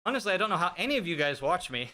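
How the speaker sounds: ordinary delivery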